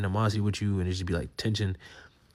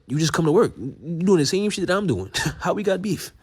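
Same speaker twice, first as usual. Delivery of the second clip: much higher and louder, laughing incredulity; laughing disbelief; 9 semitones higher, 5 dB louder than usual. Real, not acted. Young worker mocking jealous older coworkers, high laughing disbelief. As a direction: throw your hands up, laughing it off.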